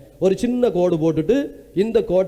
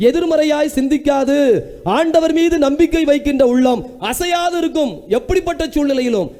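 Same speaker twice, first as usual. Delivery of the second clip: high, driving preacher's voice, each line pushed up; the Lord's promise thundered from the pulpit; fiery preaching; 5 semitones higher than usual. Acted. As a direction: preach it with rising fervour. Preaching at full force.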